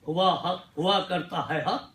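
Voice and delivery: measured Urdu verse with dramatic pauses; mushaira-style poetry delivery; ghazal recitation. Acted. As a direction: recite the couplet phrase by phrase, letting each land. Recites Urdu ghazal couplets to audience.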